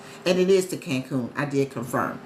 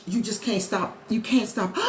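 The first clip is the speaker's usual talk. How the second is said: normal talking voice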